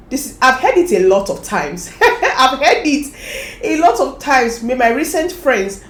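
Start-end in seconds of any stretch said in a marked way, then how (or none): 0.1-4.6 laughing while saying it. Real, not acted. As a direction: let the laugh run through the words. Laughing about being told.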